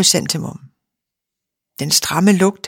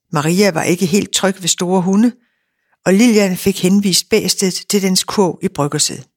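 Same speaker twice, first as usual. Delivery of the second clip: ordinary delivery, nothing marked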